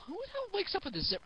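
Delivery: incredulous. Real, not acted. Mocking aside on the story.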